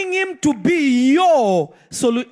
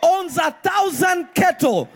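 bold prayer; 6 semitones higher than usual. Pretend. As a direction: proclaim God's riches in full voice. Preacher voicing bold prayer, shouting.

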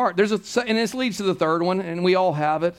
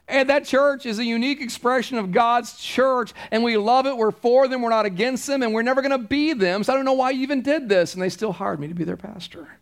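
ordinary talk

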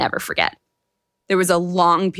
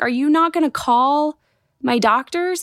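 upset demand; 7 semitones higher than usual. Retold or imitated. Patient demanding the nurse call her doctors, upset and higher. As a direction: push back hard at the nurse. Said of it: "I am so upset and worked out".

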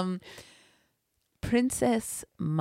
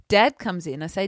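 normal talking voice

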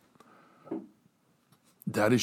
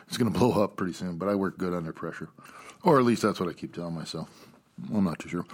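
usual speaking voice

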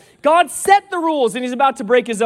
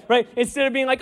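ordinary talk, nothing marked

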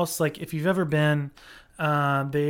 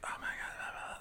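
hushed imitation of chatty moviegoers; very soft, voiceless mock chatter; mock whispering; 20 dB quieter, faster than usual. Imitates friends talking during movie.

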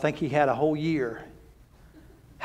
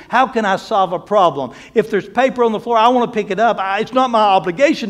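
louder, higher, rising preacher's climax; preaching at full force; 5 semitones higher, 6 dB louder than usual. Acted. Preacher urging the church to serve, full force. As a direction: build it up and hammer it home.

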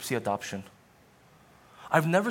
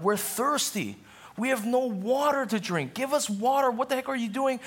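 mock complaining Israelites; 9 semitones higher than usual. Voicing the grumbling Israelites.

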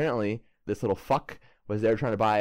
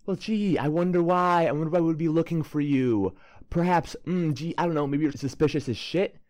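higher, mock-innocent sarcasm; sarcastic; 5 semitones higher than usual. John needling the suspect, higher mock-innocent sarcasm. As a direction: play dumb with heavy sarcasm. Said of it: "he's just like".

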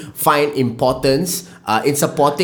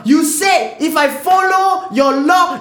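mocking complaint; 11 semitones higher, slower than usual. Mock-quoting government announcements.

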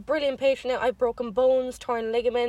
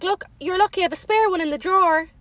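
quoting the doctor; 6 semitones higher, 5 dB louder than usual. Retold or imitated. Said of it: "And she was like".

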